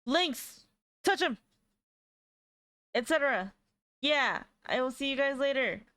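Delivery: high, loud sign-off call. Real, not acted. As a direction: call it out to chat.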